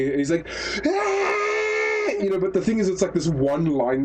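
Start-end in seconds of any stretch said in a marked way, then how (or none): 0.9-2.1 very high, sustained mock scream; imitated anime scream. Podcaster imitates main character screaming at the wall, mock scream. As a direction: imitate a crying hero's piercing one-note scream. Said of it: "he's screaming".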